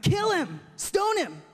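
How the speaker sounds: high-pitched mob cry; mock outcry. Crowd turning on Jesus, high mock outcry. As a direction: exaggerate an overreacting crowd, pitch way up.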